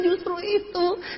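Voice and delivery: high, soft, slow whimper; mock tearful answer. Speaker admitting nobody local has won yet, tearful squeak. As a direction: squeak it out as if crying.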